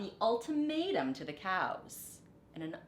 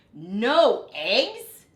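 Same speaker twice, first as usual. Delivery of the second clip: loud, higher disbelieving cry; shocked cry; 3 semitones higher, 13 dB louder than usual. A narrator's reading. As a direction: wail it out in dismay. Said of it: "cried Farmer Brown".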